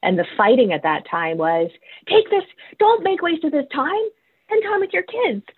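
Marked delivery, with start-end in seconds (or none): 2.1-5.4 very high-pitched mocking squeal; hammed-up high scolding voice; mock nagging. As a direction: imitate busybodies lecturing, voice pushed way up high. Mimics people arguing during lockdown.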